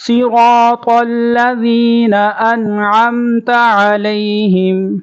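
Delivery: Quran recitation. Shown as a demonstration. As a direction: recite as a model for students. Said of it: "tajweed lesson recitation".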